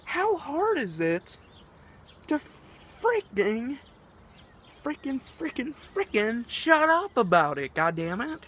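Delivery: venting at people who can't keep secrets; exasperated rant. Real, not acted. Viewer hears him rant about secrets, high and exasperated.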